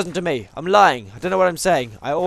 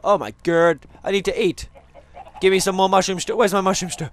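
usual speaking voice

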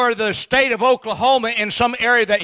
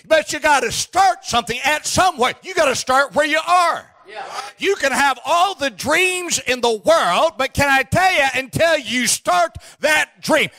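preaching at full force; 4 semitones higher than usual. Acted. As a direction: preach it hard to the congregation. Preacher rising again.

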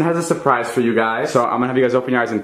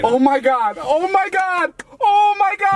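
overjoyed screaming; slower than usual. Shown as a demonstration. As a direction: scream it like the best surprise ever. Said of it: "here's a good reaction".

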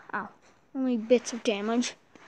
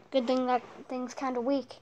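normal talking voice

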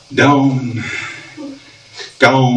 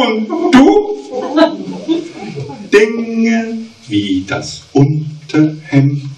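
rhythmic cabaret verse reading; mock-solemn poetry reading; poem recital; 5 semitones higher than usual. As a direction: recite the verse with comic timing.

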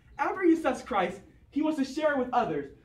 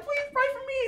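pleading patient; 12 semitones higher than usual. Acted. Hospital patients begging pastor.

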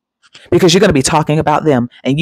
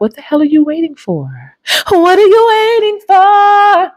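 mock outrage. Real, not acted. Teasing viewers who haven't subscribed, with mock outrage. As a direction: call out the viewers, playfully exasperated.

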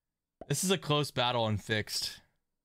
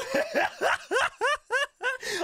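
excited laugh. Real, not acted. Celebrating lucky roll.

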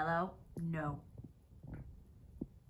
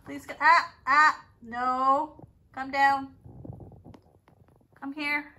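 loud firm scolding; 2 semitones higher, 16 dB louder, slower than usual. Real, not acted. Owner scolding Milo off the perch, loud. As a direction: shout the bird down. Ordering the parrot down.

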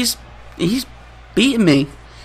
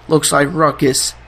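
wrestling announcer call; 4 semitones higher than usual. Pretend. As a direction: call it like a ring announcer hyping the crowd. Calls his own move like announcer.

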